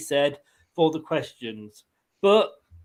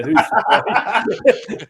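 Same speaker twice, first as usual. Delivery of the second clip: slow, stretched and mocking, with a laugh in it; drawn-out sarcasm; 4 semitones higher, 5 dB louder, much slower than usual. Real, not acted. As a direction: drag out "who's surprised" to mock the rival. Sarcastic aside on Leeds fans.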